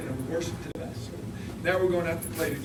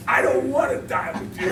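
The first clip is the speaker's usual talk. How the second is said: mock defiant; 8 semitones higher, 8 dB louder than usual. Punchline to Conrad.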